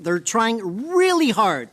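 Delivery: "really hard" drawn out slowly; stress on really hard. Real, not acted. Committee member dismissing opponents' efforts, stretching "really hard".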